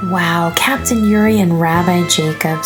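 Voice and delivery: ordinary delivery, nothing marked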